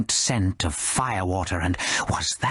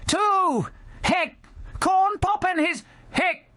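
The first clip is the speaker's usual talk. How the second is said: put-on drunken shout. Drunk Scamala shouting and raising her cocktail. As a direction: yell it toasting the room. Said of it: "she shouted".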